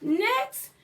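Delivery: plain reading voice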